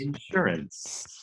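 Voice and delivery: slow, soft INsurance, first syllable stretched; Southern first-syllable stress. Shown as a demonstration. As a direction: draw out the IN of Insurance. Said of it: "the emphasis on words".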